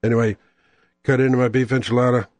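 ordinary talk, nothing marked

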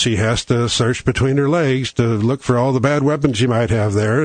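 usual speaking voice

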